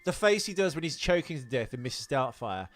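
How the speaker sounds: usual speaking voice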